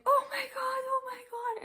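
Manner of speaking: high, loud panicked squeal; panicked nurse. Retold or imitated. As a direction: shriek it like a frightened young nurse. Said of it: "she starts freaking out".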